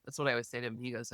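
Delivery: usual speaking voice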